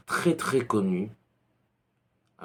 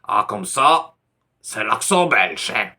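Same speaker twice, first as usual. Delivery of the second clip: louder mock teasing, imitating a Frenchman ribbing the Belgian accent; a louder, teasing imitation of French friends laughing at a Belgian; 6 dB louder than usual. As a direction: poke fun at the accent like a cheeky French mate. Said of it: "ont toujours l'air, euh, de le dire et de ré-, rigoler".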